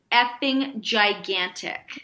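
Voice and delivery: slow emphatic. Real, not acted. Drawn out emphasis.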